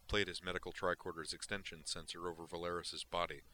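usual speaking voice

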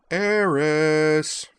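"Eris?" long, high and loud on a held tune; sing-song call. A narrator's reading. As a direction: sing out "Eris?", stretching both syllables. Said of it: "said in a sing-song tone".

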